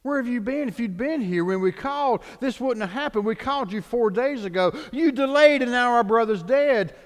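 grieving sisters rebuking him; reproachful questioning. Retold or imitated. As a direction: blame him for coming too late. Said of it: "they questioned".

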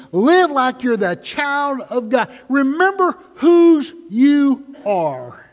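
loud, high and slow, stretched out; preaching shout. Acted. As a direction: thunder it from the pulpit. Preacher proclaims.

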